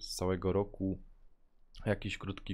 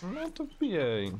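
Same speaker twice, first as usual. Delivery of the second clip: excited call; 12 semitones higher, slower than usual. Real, not acted. Inviting friend into the game.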